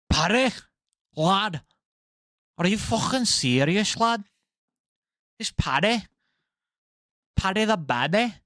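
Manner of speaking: playful Liverpool lad impression in a Scouse accent. Mimicking the fighter's brag in a Scouse accent. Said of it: "I can't hold an accent".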